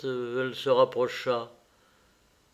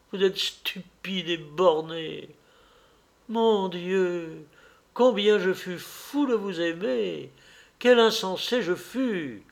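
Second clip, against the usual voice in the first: impassioned, higher, emphatic reproach; Dorian, scornful lover; 5 semitones higher, 3 dB louder than usual. Acted. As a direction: pour out bitter accusation and regret. (amant déçu, tirade pleine de mépris)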